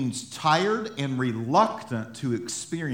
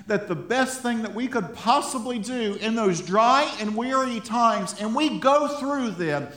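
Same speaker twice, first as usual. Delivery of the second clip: higher, louder, fervent exhortation; preaching at full force; 8 semitones higher, 4 dB louder than usual. Acted. Pastor rallying his congregation, preaching with full force. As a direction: drive it home to the church.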